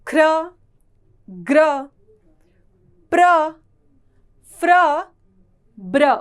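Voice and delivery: ख्र ग्र प्र फ्र ब्र each punched out loud and alone; loud cluster drill. Shown as a demonstration. Teacher drills students on र clusters, loud and clear. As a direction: call out each cluster clearly with a pause after it. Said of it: "इस प्रकार मात्रा का इस्तेमाल करेंगे".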